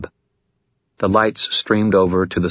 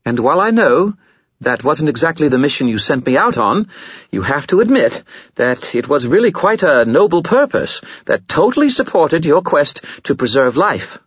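higher, louder, hurried nervous talk; hurried stammer; 9 semitones higher, 4 dB louder than usual. Acted. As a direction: rush the excuse in panic. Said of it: "hurried, stammering edge".

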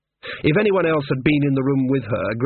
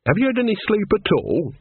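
higher, pleading worried question; worried old woman; 8 semitones higher than usual. A narrator's reading. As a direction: coax an answer out of her. Nanny worries.

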